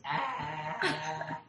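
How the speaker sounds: small, high imitation of a toddler; Oli's tiny voice. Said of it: "in that tiny little Oli voice".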